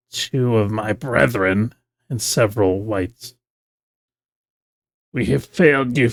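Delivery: rasping, sibilant voice from an orb; plague bringer voice. Acted. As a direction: hiss it out like a servant begging. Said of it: "you hear a rasping, sibilant voice emanating from the orb".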